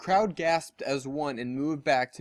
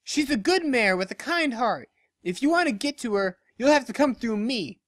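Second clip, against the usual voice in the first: higher, firm challenge; loyal defiant friend; 8 semitones higher than usual. Acted. Barry daring Dash to come through her, defiantly. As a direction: plant your hooves.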